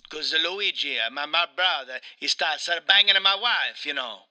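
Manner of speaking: wronged Italian husband, hammed up; Mario with Italian accent. Mario confides his brother's betrayal, in a hammed-up Italian accent. Plays Mario being interviewed.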